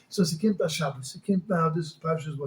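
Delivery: ordinary talk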